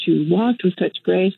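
usual speaking voice

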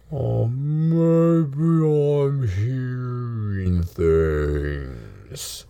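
very slow, puzzled giant voice; huge beast doubting its own ears; slow giant creature. Acted. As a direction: drawl it out, sinking low at the end. Game master voices giant Momo.

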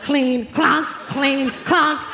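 emphatic, punched clanks; mock angry scrubbing noises; imitating clanging pots. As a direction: bang the words out like pots and pans. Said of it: "through the sound of the pots and pans".

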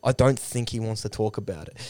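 low, softened mock mutter; muttering under breath. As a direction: drop low and quiet, as a muttered aside. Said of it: "mutters under his breath".

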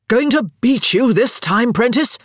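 put-on loud, high, cocky drunk boasting; drunken boast. A narrator's reading. Daniel boasting drunkenly to Prentice at cards. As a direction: crow it like a tipsy charmer. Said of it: "Daniel boasted".

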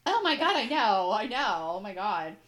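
getting emotional. Real, not acted. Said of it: "it's gonna make me cry".

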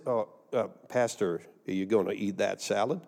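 low, soft, hesitant query; tentative question. Retold or imitated. Retold polite question from the pastor.

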